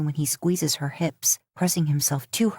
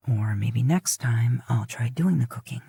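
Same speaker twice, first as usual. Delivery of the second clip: low whisper to himself; low, private whispered thought; inner thought whispered; 6 semitones lower than usual. A narrator's reading. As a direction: whisper the thought, not said aloud. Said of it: "he thinks".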